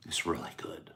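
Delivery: unvoiced soft whisper. Real, not acted. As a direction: let it fall to a whisper. Whispered aside.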